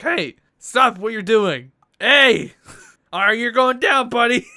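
loud, high, slowed threat; mock yelling guard. A guard threatening the player, loud mock yelling. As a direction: yell it like a trigger-happy guard. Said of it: "He's like".

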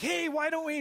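high-pitched, eager husband's suggestion; imitated husband, pitched well up; eager fixer husband. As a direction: jump in as a husband offering a fix. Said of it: "and you're like".

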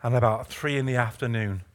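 low, halting, hushed scripture delivery; solemn preaching. Real, not acted. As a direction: drop low and soft, phrase by phrase.

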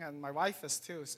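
ordinary delivery

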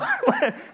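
a sudden high laugh; high burst of laughter. Real, not acted. Preacher laughs at his own plane story mid-sermon. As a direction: laugh out at the memory.

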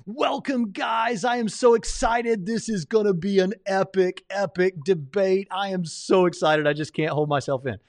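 high, gushing excited host voice; overexcited debate host, all pumped up; James impression. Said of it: "here's my James impression".

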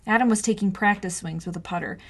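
plain reading voice

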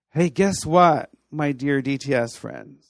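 ordinary delivery

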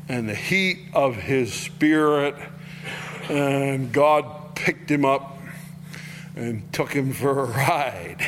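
normal talking voice

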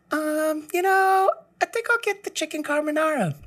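very high woman's voice ordering; lady customer voice. Retold or imitated. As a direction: play a hesitant lady ordering a sandwich.

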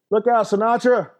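high, loud teasing shout across the stage; teasing call. Retold or imitated. Son teases crooning father onstage, high loud playful call. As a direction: rib him loud from the wings.

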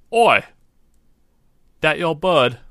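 loud, high rough call from a street sweeper; gruff street voice. Acted. Street sweeper calling out to a stranger with a bird, loud and rough. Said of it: "he hears a voice behind him go".

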